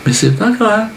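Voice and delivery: very high, sugary reassurance; mock cheery fairy. Charity fairy consoling a dying boy, mock cheery. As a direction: brush it off in a silly fairy voice. Fairy brushes off child's illness.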